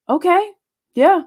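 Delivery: bemused okay. Retold or imitated. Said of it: "she was just like".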